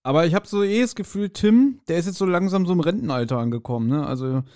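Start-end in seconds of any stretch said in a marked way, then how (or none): none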